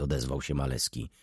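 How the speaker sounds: plain reading voice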